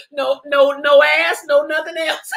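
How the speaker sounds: usual speaking voice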